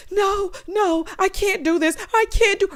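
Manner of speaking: high, frantic imitation of her panic; mock panicked refusal. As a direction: ham up her scared "no". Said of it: "Here she go".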